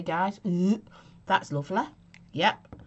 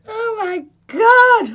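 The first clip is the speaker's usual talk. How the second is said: shocked cry; 13 semitones higher, 11 dB louder, much slower than usual. Real, not acted. Laughing fit after nose squirt.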